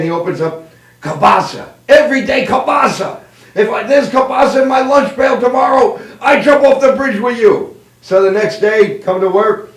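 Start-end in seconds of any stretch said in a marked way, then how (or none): 1.0-7.8 put-on high comic Polish accent, building louder; exasperated Polish man. Polish worker finds kielbasa in his lunch, exasperated Polish accent. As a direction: gripe like a fed-up Polish worker. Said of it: "So the Polish man".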